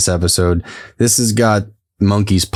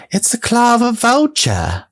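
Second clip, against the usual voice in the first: very high, drawn-out witch impression; hammed-up high witch voice, stretching every word; imitating the old witch. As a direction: mimic her in a slow, piping falsetto. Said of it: "almost exactly like that".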